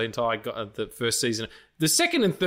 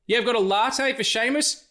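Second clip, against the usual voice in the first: barista calling out; 9 semitones higher than usual. Retold or imitated. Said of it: "the barista at the end calls your name".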